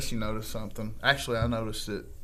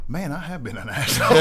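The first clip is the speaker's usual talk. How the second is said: laughing; 5 semitones higher, 8 dB louder than usual. Retold or imitated. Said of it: "sat back and goes".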